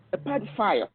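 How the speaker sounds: ordinary talk, nothing marked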